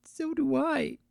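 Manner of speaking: high, soft tearful filly; barely holding back tears; sniffling. Acted. Filly agreeing with her brother, sniffling softly. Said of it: "Pumpkin sniffled".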